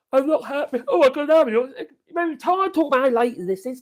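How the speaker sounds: loud, high mock complaint; loudly put-on grumble; mock indignant.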